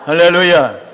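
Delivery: loud preacher's hallelujah; full-voiced exclamation of praise; rallying shout. Real, not acted. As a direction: call it out to the whole congregation. Preacher rallying congregation after blessing.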